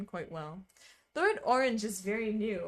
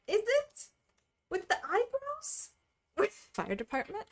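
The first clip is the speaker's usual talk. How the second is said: incredulous; 12 semitones higher than usual. Real, not acted. Incredulous question.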